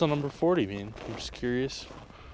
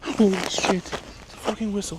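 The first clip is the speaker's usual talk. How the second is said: scared; 9 semitones higher, 4 dB louder, slower than usual. Real, not acted. Hears whistle, scared.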